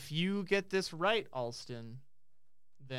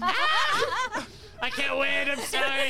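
high, loud laughing wail; laughing in mock defeat; 11 semitones higher, 8 dB louder, much slower than usual. Real, not acted. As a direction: howl it through laughter.

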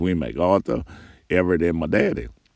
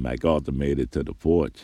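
usual speaking voice

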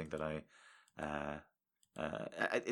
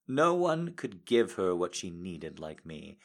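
usual speaking voice

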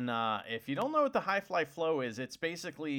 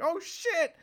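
high, loud startled cry; surprised shout; 13 semitones higher, 5 dB louder than usual. Retold or imitated. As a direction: yelp it out in shock. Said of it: "and I was like".

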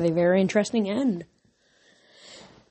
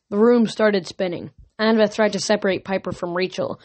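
ordinary delivery

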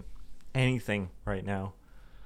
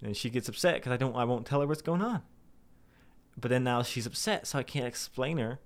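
ordinary talk

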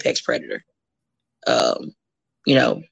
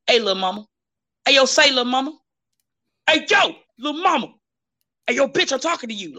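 mock catcalls climbing higher and more insistent; imitated catcall yelling; 8 semitones higher, faster than usual. Man hollering at woman pumping gas, escalating mock yell. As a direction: imitate a catcaller getting pushier with each ignored call. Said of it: "yelling at a female".

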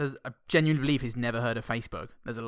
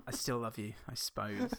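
grudging mock motherly love; disappointed mum; 2 semitones lower, 8 dB quieter than usual. Mum to failed son, softly disappointed. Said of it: "very evocative of a disappointed mum".

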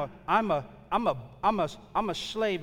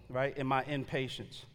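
ordinary delivery

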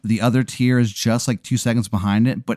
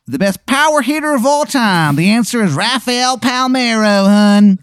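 high, loud, hammed-up Maryland accent; bad Maryland accent; 12 semitones higher, 5 dB louder than usual. Shithead Steve offering to answer any question, bad Maryland accent. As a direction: go way up in pitch with a terrible Maryland twang. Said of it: "it's the worst Maryland accent".

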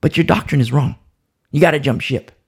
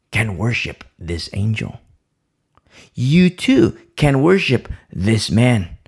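normal talking voice

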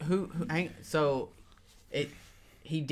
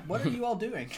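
higher-pitched, not raised in volume; bewildered question; 6 semitones higher than usual. Acted. Player questioning the party, high puzzled voice. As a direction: ask it high, baffled.

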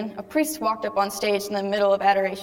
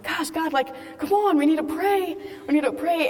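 mock pleading; 6 semitones higher than usual. Pleading with God mid-laughter.

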